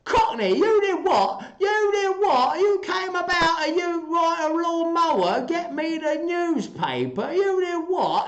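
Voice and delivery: very high, loud bad Cockney accent. Shown as a demonstration. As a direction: squawk it as a caricature Cockney. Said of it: "can you make a Cockney accent".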